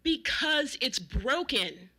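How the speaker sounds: slow, loud, spelled-out exasperation; loud, laboured shout; exasperated shout. A narrator's reading. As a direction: drag out each word, fed up. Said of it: "even more exasperated, shouted".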